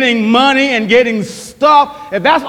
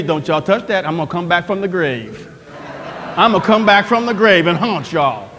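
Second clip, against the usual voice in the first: dad's joke threat; 6 semitones lower, 3 dB quieter than usual. Retold or imitated. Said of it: "my dad told me".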